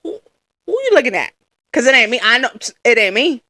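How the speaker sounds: a touch of sassy attitude; lightly sassy "who you looking at"; sassy retort. Retold or imitated. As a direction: brush him off with mock sass.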